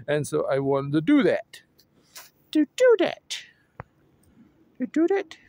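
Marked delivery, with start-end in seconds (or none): 2.5-3.4 very high playful echo; high-pitched repeat. Pretend. Repeating own wish to do that, very high voice. 4.8-5.4 high echo, lifting at the end; high-pitched repeat. Pretend. Repeating own wish to do that, high voice.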